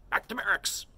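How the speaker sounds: high, loud stern bark of an order; stern command. A narrator's reading. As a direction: snap it out like a drill sergeant. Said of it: "he said sternly".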